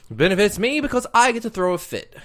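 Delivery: high, loud protest; mock tantrum. Co-host defending his wasted minutes, mock tantrum. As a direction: throw a playful loud fit. Playfully throwing a fit at co-host.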